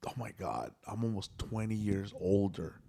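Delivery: low, soft, sinking voice; quiet dismay. Retold or imitated. Coworker realizing she's almost twenty years older, quiet dismay. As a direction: say it small and low, privately stunned.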